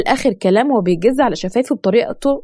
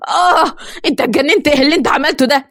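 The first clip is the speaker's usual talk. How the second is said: higher, slowed cry of pain; pained scream; 4 semitones higher than usual. A narrator's reading. Yasser crying out after his arm is broken, in pain. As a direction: yelp as the arm breaks. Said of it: "وقتها صرخ ياسر بقوة".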